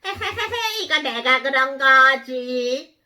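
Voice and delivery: cartoon bug with a mischievous laugh; gloating caterpillar. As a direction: confess gleefully like a naughty little villain. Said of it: "애벌레가".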